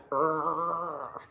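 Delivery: low mama bear growl. A narrator's reading. Storyteller as mama bear warning strangers off, growling. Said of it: "so she growled".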